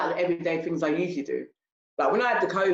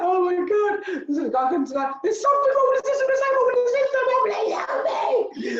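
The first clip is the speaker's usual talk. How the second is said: high, wailing, half-laughing sob, much higher and louder; imitating her own tearful meltdown, pitched way up; blubbering breakdown; 4 dB louder than usual. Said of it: "I was like a blubbering baby".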